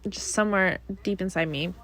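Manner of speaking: usual speaking voice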